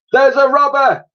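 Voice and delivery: very high loud shout; shout from below. A narrator's reading. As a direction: yell an alarm from far below. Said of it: "a shout from the ground".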